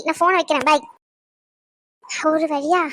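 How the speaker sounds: ordinary talk, nothing marked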